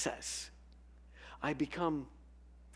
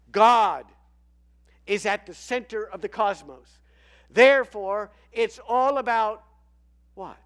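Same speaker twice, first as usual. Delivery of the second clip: loud, ringing declaration; booming proclamation; 8 semitones higher, 14 dB louder than usual. Acted. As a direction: proclaim it to the rafters. Goodness voiced, loud.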